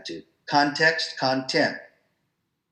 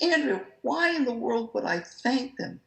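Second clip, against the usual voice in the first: high incredulous question, lightly played; imitating his own puzzled protest; mock disbelief; 12 semitones higher than usual. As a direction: act baffled by the idea.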